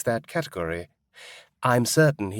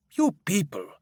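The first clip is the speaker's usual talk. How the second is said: scornful scoff; 6 semitones higher than usual. Acted. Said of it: "He laughs to himself".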